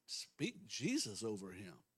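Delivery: usual speaking voice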